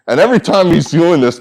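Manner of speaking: usual speaking voice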